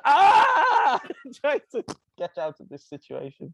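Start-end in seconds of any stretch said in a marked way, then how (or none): 0.0-1.0 much higher, loud alarmed yelp; a sudden yelp of panic; panicked yelp. Retold or imitated. Parent panicking as the child shouts out the window, loud yelp.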